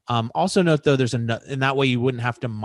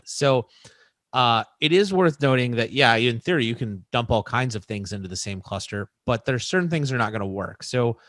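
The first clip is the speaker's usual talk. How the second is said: ordinary delivery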